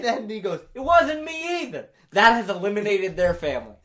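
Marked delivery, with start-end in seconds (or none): 0.7-1.9 high, slow mock protest; protesting denial. Second brother clearing himself, mock indignant. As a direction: draw out a high, defensive denial.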